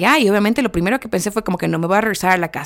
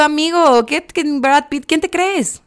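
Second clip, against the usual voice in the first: mock outrage; 7 semitones higher, slower than usual. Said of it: "Y yo".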